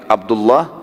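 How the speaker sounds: ordinary talk, nothing marked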